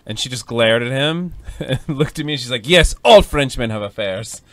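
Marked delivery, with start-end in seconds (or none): 2.7-4.4 put-on slow, loud French-accented pronouncement. French wife glaring at her husband, emphatic French accent. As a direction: declare it slowly in a French accent. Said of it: "That's my French story".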